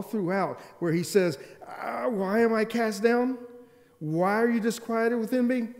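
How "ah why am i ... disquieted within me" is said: anguished lament. Acted. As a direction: cry out to your own downcast soul. Acting out the psalmist's anguished questioning.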